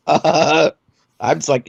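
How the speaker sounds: ordinary delivery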